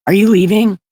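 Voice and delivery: loud, high plea to stay; calling out. Real, not acted. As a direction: call after someone walking away. Calling after someone who is leaving.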